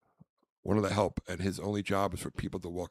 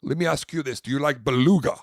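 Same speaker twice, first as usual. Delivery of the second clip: higher, louder showy pitch; pretend caviar connoisseur; 4 semitones higher, 8 dB louder, faster than usual. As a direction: pose the question grandly, punching the last word. Plays pompous caviar seller.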